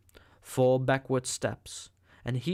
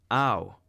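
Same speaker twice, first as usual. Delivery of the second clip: yelp of pain; 5 dB louder than usual. A narrator's reading. After prodigious slap.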